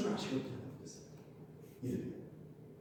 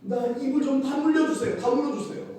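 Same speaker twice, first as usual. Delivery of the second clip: pleading prayer; 11 semitones higher, 5 dB louder than usual. Shown as a demonstration. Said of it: "어떻게 해야 돼요?".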